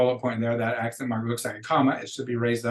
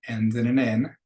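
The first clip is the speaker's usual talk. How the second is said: normal talking voice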